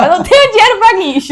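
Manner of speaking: high, loud pleading cry; retold yell. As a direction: yell the same plea again at the cop. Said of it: "Falei pro policial".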